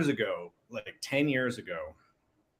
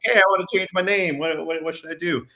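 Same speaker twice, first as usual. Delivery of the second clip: eager caller; 7 semitones higher, 7 dB louder than usual. Retold or imitated. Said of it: "I called them in".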